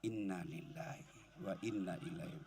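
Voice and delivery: low and barely audible; soft slow murmur. Shown as a demonstration. Husband quietly reciting after wife's nagging, soft murmur. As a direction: murmur it walking away. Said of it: "lalu kita tinggalkan".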